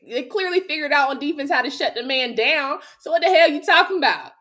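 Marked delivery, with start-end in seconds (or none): 3.0-4.3 heated retort. Real, not acted. Arguing back at co-host.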